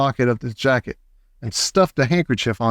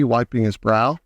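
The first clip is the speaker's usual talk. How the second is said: ordinary delivery, nothing marked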